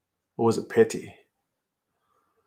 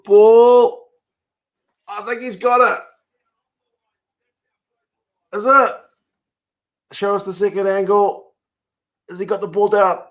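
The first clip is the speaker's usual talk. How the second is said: excited; 7 semitones higher, 3 dB louder than usual. Real, not acted. Watching tense replay.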